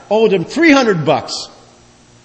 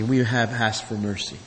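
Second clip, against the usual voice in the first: soft echo; 5 semitones lower, 8 dB quieter than usual. Shown as a demonstration. Preacher echoing his own point to the congregation, softly. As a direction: repeat it much softer, almost to yourself.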